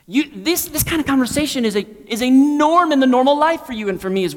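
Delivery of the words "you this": ordinary delivery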